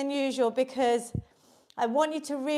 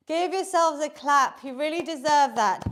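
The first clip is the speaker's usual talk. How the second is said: usual speaking voice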